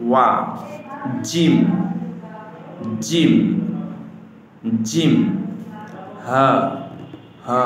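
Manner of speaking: শিন, সাদ, হা each recited distinctly, with long pauses; careful letter drill. Shown as a demonstration. Teacher drills red letters for correct recitation, careful pronunciation. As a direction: recite জিম and each letter singly, as a model. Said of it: "শুদ্ধ সঠিক উচ্চারণে".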